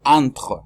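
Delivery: "entre" with the R from the throat; throaty French R. Shown as a demonstration. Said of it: "pronunciar a la R con la garganta". (entre con R francesa de garganta)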